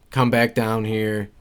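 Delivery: ordinary talk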